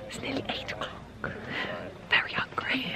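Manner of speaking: hushed hobbit whisper. Pretend. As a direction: breathe it like a hungry hobbit.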